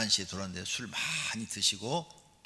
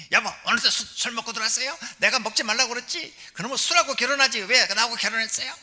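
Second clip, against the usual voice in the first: high, loud tirade of a nagging wife; 11 semitones higher, 9 dB louder than usual. Acted. Wife berating husband for drinking again, loud high nagging. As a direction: scold your husband at the top of your voice, pitched high. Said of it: "이렇게 하면 이거 바가지 긁는 거고".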